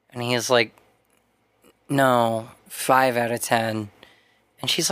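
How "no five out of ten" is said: low, slow deadpan imitation; mock-gloomy correction, dragged out; glum customer. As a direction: sink down low like a downbeat guy. Voices Mac correcting the clerk.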